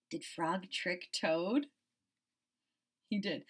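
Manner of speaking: ordinary delivery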